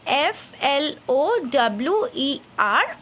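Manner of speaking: slow, careful letter-by-letter spelling; spelled out slowly. Shown as a demonstration. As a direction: dictate each letter to the class. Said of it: "I will write the first word".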